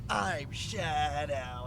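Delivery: very slow, lightly edgy mock Shadow; terrible Shadow voice. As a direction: drag it out like a bad edgy hero. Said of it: "He sounds like this".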